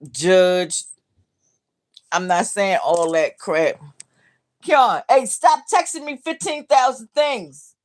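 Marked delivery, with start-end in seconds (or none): 4.6-7.7 higher and louder, calling across the house. Real, not acted. Mother calling her texting son in the other room, loud. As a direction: shout to the son in the next room.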